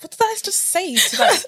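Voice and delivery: squeaky shock at own answer; panicked disbelief. Retold or imitated. Surprised speaker after agreeing to a workshop, high disbelief. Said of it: "I was like".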